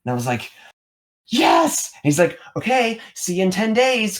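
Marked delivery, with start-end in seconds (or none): 1.3-1.9 high, loud, delighted yes; excited yes. Retold or imitated. Accepting the retreat job offer on the phone, thrilled. 2.6-4.2 high, brisk voice of the caller; caller signing off. Retold or imitated. Caller from the retreat signing off, lightly voiced.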